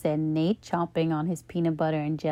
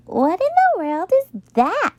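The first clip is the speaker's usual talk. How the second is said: much higher, startled question; shocked; 12 semitones higher, 4 dB louder than usual. A narrator's reading. Leslie staring at Carla's sandwich, shocked. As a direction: gasp at the strange sandwich. Kids mocking a lunch.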